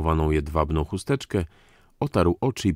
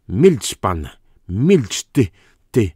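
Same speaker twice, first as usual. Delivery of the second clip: much louder, explosive command; furious shout; 4 semitones higher, 8 dB louder, slower than usual. A narrator's reading. Count silences Dyzma for naming Kunicki, furious shouting. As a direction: shout him down in a rage. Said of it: "Ponimirski zerwał się na równe nogi".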